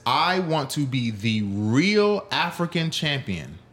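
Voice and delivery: slow, deliberate quote leaning hard on "real"; stress on real. Retold or imitated. Streamer quoting the fighter to make a point, stress on "real". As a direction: draw out "real" as the key word. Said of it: "the key word here is the word real".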